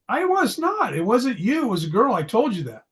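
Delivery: high-pitched defensive younger brother; kid protesting. Retold or imitated. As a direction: squeak it out like a scared little brother. Younger self protesting to bully.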